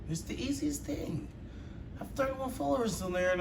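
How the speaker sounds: mock whiny plea. Reactor begging his viewers for follows in a mock whine. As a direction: whine like a pitiful beggar.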